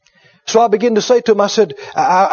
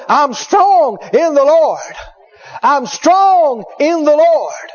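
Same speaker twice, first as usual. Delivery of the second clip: imitating a revived patient, high and drawn out; strong, loud confession; 6 semitones higher, much slower than usual. Revived patient sitting up in bed declaring faith, strong and high. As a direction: declare it slowly with STRONG stretched out. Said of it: "talking so loud you could hear 'em outside".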